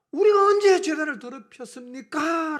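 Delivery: loud, high, drawn-out objection; indignant protest. Acted. Israelites answering God's charge, in indignant protest. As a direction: protest loudly as if falsely accused. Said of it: "항변이 뭡니까?".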